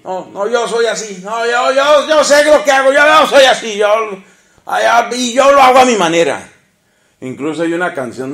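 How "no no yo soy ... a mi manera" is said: swaggering mock of a man defending his ways; stubborn man. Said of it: "Los hombres somos así". (hombre orgulloso imitado, alto y fuerte)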